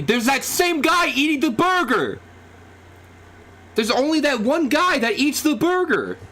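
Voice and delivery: high-pitched excited yell. Real, not acted. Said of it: "I'm sorry I'm yelling".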